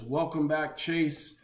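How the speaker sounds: ordinary talk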